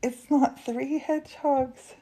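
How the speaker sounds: high, drawn-out correction; emphatic repeat. Real, not acted. Repeating it to someone who asked what, emphatic and high. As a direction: say it again slowly, pitched right up.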